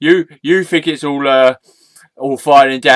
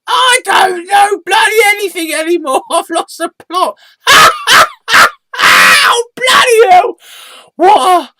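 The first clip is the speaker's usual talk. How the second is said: very high, loud protest breaking into laughs; hysterical squeaky alter ego; 8 dB louder than usual. Acted. Sidekick has lost the plot, high-pitched and giggling. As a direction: squeal helplessly between cackles.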